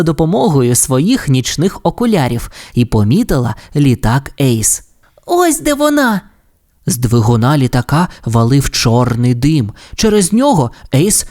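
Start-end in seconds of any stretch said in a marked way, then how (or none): 5.3-6.2 much higher cartoon pup voice; excited pup pilot. A narrator's reading. Sky spots Ace's plane, excited high pup voice. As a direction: call out the sighting in a high voice.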